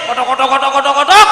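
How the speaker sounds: put-on chug the words out like an old motorbike; rapid repeated engine noise, swelling at the end; mock motorbike sputtering; imitated motorbike putter. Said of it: "pakai sepeda motor sih".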